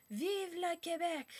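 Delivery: high, drawn-out French slogan cry; mock separatist rallying cry. Imitating extreme separatist people, a high mock rallying cry. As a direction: proclaim it like a die-hard patriot.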